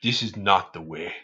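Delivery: hushed deep warning, measured; deep Allstate-guy voice. Retold or imitated. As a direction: drop deep and solemn, imitating the ad spokesman.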